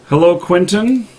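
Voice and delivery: ordinary delivery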